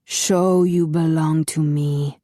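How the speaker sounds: slow, muffled, menacing master; muffled masked villain. A narrator's reading. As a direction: speak slowly behind a mask. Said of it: "his muffled voice".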